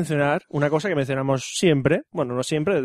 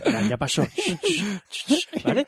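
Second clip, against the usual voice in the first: put-on soothing a child; 5 dB quieter than usual. Hushing Fran like a crying child.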